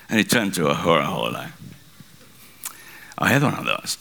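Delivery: normal talking voice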